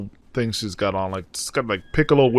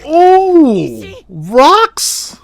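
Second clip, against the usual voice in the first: high, loud startled cry; real startled exclaim; surprised squeal; 7 dB louder than usual. Real, not acted. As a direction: react in shock. Surprised by rocks on screen.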